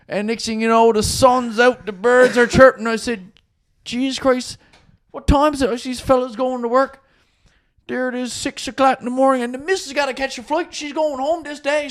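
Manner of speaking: excited retelling. Retold or imitated. Retold story told high and excited.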